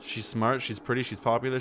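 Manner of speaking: ordinary talk, nothing marked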